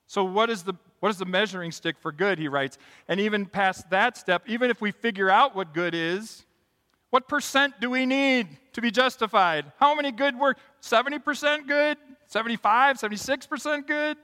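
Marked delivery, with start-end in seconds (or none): 3.1-14.2 rising, louder, incredulous questions; mounting questions. Real, not acted. Pastor to congregation, building rising questions. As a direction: push the questions higher and louder.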